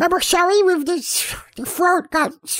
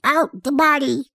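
usual speaking voice

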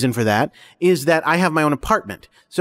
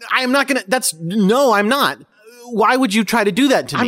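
higher-pitched, wounded refusal; upset protest; 7 semitones higher than usual. Acted. Jordan refusing the image of his mother, upset and higher. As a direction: push the idea away in rising upset.

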